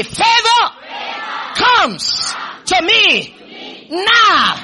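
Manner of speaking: high, slow rallying chant; shouted with energy. Shown as a demonstration. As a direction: shout each phrase high for the congregation. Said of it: "Say that with energy".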